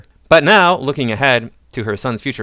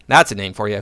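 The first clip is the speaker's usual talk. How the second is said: ordinary delivery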